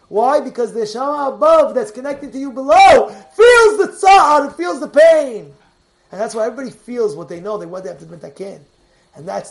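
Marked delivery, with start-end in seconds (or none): 0.1-5.5 loud, high, driving sermon voice; fiery preaching. Acted. Rabbi firing up his listeners with a loud sermon. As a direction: preach it out to the congregation at full force.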